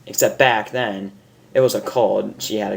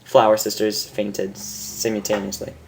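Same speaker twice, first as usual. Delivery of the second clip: usual speaking voice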